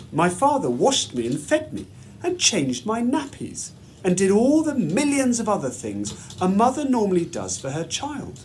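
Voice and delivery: ordinary delivery, nothing marked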